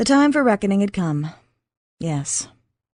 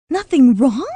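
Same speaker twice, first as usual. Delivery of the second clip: higher, louder panicked squeak; 6 semitones higher, 8 dB louder than usual. Acted. J C reacting to Max's calm, shrill disbelieving squeak. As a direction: disbelief bursting out. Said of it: "she squeaked".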